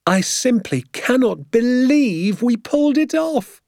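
very high, slow, giddy amazement; elated disbelief. A narrator's reading. Father amazed they pulled it off, elated disbelief. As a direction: marvel in a high, drawn-out voice.

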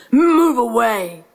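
slow, drawn-out lion roar. Acted. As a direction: roar it slowly to drive rivals off. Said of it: "roared the lion".

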